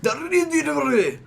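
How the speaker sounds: put-on high, slow Japanese anime accent; drawn-out anime-style Japanese accent. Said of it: "say it in the Japanese accent".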